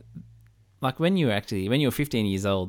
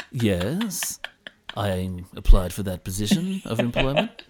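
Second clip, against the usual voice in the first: louder, deepened polished formal voice; job-interview voice; 4 dB louder, slower than usual. Retold or imitated. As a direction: sound like a newsreader at an interview. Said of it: "you put this voice on".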